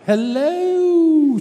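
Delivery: very high, loud, plummy posh greeting; posh hello. Retold or imitated. Mimicking the posh grey-haired man greeting the lads. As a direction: sing out a mock upper-class hello. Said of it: "talking like that, posh".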